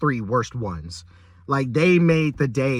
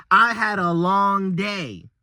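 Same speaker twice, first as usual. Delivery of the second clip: mocking whine; 6 semitones higher, slower than usual. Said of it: "Her excuse was".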